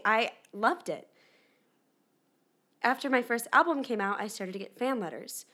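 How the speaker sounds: ordinary talk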